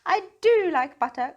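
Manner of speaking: high, loud happy cry; a gleeful little train; cried out. A narrator's reading. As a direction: cry it out with delight. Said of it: "cried Tootle".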